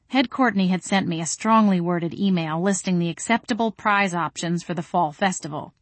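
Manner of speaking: plain reading voice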